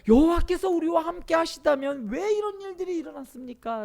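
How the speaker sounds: loud, high-pitched challenge to God; Gideon's protest. Acted. (높고 큰 소리로 따져 묻는 기드온)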